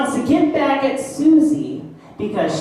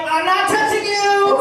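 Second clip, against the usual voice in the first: put-on high taunting child voice; teasing kid; 8 semitones higher than usual. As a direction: needle your brother, sing-song. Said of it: "saying stuff like".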